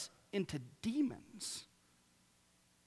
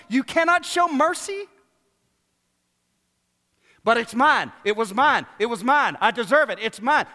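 loud, high, driving sermon voice; preaching at full force; 9 semitones higher, 13 dB louder than usual. Real, not acted. Preacher urging the church to forgive, loud full-force preaching. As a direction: preach it hard to the congregation.

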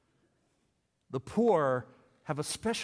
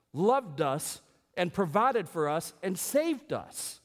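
ordinary talk, nothing marked

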